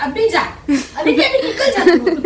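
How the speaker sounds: ऊँची, ज़ोरदार आवाज़ में बच्चे की नकल. Retold or imitated. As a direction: mimic a child shooing mum away, loud and high-pitched. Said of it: "मेरा बच्चा मुझे भगा देता है". (बच्चे की तरह भगाते हुए)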